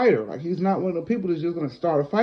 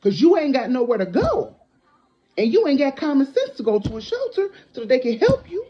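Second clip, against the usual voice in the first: heated, raised scolding; angry; 6 semitones higher, 5 dB louder than usual. Real, not acted. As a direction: chew him out, voice up high. Telling off the ex.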